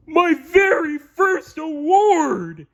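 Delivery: Patrick voice, high and slow, shouting with glee; a dopey starfish bursting with joy; gleeful cry. A narrator's reading. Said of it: "he cried with glee".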